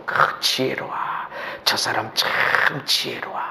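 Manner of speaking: sly mocking whisper; preacher whispering mock praise of a sly man.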